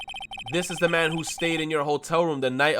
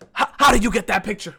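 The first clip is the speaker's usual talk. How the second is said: gasp, then higher, louder stammer; mock shock with a sharp gasp; imagined shocked witness; 5 semitones higher, 6 dB louder, faster than usual. Imagining the witness seeing the photograph, hammed-up shock. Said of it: "She's gonna be like".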